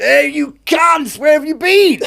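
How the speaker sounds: very high, drawn-out shouted hail; indignant yell. Retold or imitated. Boss hailing his late crew off Cannes, high re-enacted shout. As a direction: holler it across the water in mock outrage.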